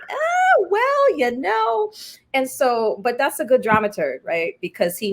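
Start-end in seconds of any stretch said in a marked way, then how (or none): 0.1-1.9 very high, slow, teasing imitation; mock shrug from Keith. Playwright imitates dramaturg's knowing reply, high and slow. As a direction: draw it out like a knowing mentor.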